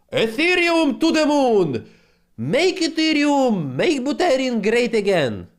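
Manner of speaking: high, loud hype shout; shouting Ethereum to the moon; excited screaming. Real, not acted. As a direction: yell it like a crypto hype man. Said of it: "I think I'm screaming".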